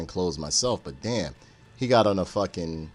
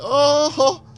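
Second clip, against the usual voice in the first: very high loud toy chuckle; toy bear laugh; 11 dB louder than usual. Retold or imitated. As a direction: squeal it like the talking bear. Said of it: "the bear would just say".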